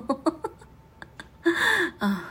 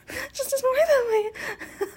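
laughing; 4 dB louder than usual. Acted. Laughing apology.

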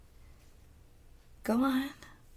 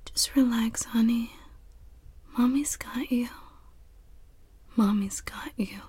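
put-on soothing a child; 5 semitones higher than usual. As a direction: hush and comfort, very gently.

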